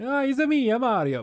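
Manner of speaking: a cartoonish Italian 'it's-a me' impression; mock Italian Mario accent. Streamer mocking a possible Mario voice, hammed-up Italian accent. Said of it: "like, a super Italian".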